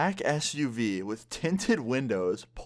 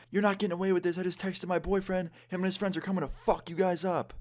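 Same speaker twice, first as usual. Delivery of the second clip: much higher-pitched mock threat; imitating angry drunk girl; 9 semitones higher than usual. Drunk girl following them warns her boyfriend is coming, imitated anger. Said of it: "so drunk and so angry".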